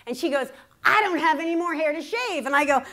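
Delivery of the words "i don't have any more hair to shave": higher, louder acted retort; mother's loud retort. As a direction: imitate mother's indignant outburst.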